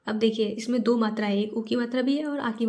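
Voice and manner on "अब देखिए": ordinary talk, nothing marked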